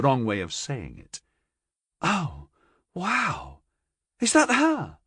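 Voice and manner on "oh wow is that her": excited gasp. A narrator's reading. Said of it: "Carilla demanded".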